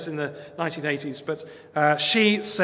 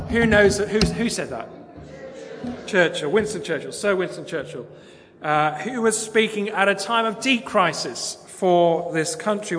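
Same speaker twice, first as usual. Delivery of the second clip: ordinary talk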